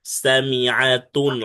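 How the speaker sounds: সামিআতুন with a clear ain before আতুন; clear ain sound. Shown as a demonstration. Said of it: "আইনটা ভালো করে উচ্চারণ করতে হবে".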